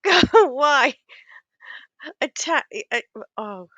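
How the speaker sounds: ordinary delivery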